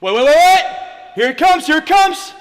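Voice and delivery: loud, eager hype; excited yelling. Acted. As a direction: shout it like the big moment's coming. Hyping his own replay.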